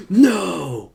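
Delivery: loud, high drawn-out cry of disbelief; mock shock. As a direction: re-enact a shocked outburst. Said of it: "I was more shocked".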